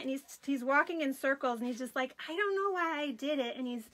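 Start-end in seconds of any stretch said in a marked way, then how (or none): 2.3-3.6 put-on little boy voice. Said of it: "his little voice is the cutest".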